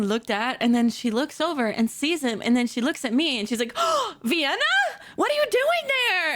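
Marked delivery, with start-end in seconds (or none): 3.8-6.3 gasping, high-pitched surprise; startled, high imitation of a friend; astonished friend. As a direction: call out in high disbelief. Acted friend spotting her, surprised.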